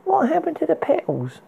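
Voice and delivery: high playful teasing question. Acted. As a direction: tease him lightly. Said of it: "she teased".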